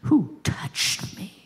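slow breathy whisper; whispered question. Acted. Jesus asking the crowd who touched him, whispered. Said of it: "he said".

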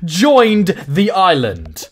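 outraged disbelief. Real, not acted. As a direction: balk at the price, stunned. Outraged at villager's demand.

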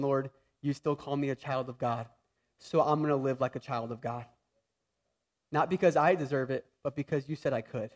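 soft, low confession to God; a hushed, humble prayer of thanks; quiet prayer. Shown as a demonstration. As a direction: pray it softly, just between you and the Lord. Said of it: "We need to confess still".

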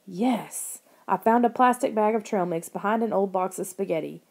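ordinary delivery, nothing marked

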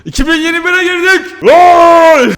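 high, loud celebratory shout; excited shout. Real, not acted. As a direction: cheer the new year. Havai fişek, coşkulu bağırış. (çok yüksek, coşkulu bağırış)

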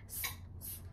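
hissed "S, S, S" unvoiced like a snake; s sound. Shown as a demonstration. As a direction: stretch each "S" into a hiss. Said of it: "S is for strawberry".

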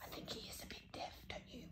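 soft unvoiced aside; whisper. Real, not acted. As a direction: whisper it to the listener. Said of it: "aside whispered to Ted".

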